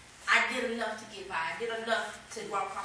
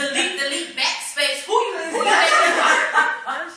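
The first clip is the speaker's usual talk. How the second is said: loud, laughing indignant rant; hammed-up outrage at the keyboard; mock furious typing; 7 semitones higher, 13 dB louder, slower than usual. To the congregation, acting out raging at her paper, laughing. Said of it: "And I'm mad".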